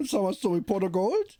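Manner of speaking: high laughing Irish leprechaun voice; mock Irish leprechaun, shrieking it. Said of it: "Sounds Irish".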